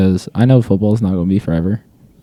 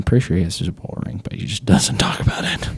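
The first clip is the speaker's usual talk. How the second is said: whispered aside; 6 dB quieter, faster than usual. Real, not acted. Aside about coach's ring.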